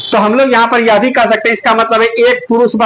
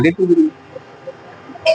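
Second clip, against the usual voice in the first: drawn-out prompt; 3 semitones lower, 5 dB quieter, much slower than usual. Shown as a demonstration. Teacher drawls answer for class.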